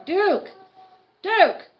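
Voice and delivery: loud, high sharp call of the dog's name; yelling at the dog. Real, not acted. Owner yelling at the noisy dog, loud and high. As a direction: shout him down over the noise.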